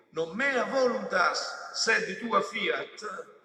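very slow, loud, high intoned prayer, stretching each word; solemn intoned Latin. Acted. Preacher repeating Jesus' Latin prayer, slow solemn chant.

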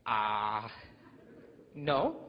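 drawn-out hesitation, then a short reply; hesitant answer. Retold or imitated. Teenage boy cornered by his girlfriend's question, hesitant. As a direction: stall on a low note before answering.

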